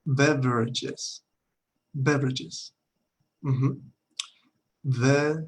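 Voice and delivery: Beverages said very slowly, sound by sound; slow English teaching model of Beverages; slow pronunciation demo. Shown as a demonstration. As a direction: spell out Beverages slowly for students. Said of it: "Eso es un poco más complicado de pronunciar".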